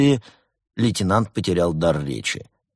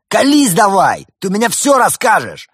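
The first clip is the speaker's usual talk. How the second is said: bad cop yell; 13 semitones higher, 9 dB louder than usual. A narrator's reading.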